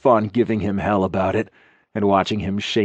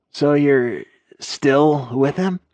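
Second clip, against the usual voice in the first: concerned; 5 semitones higher, much slower than usual. A narrator's reading. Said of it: "he didn't sound like his usual self".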